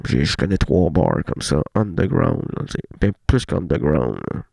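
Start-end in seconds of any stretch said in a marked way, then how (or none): none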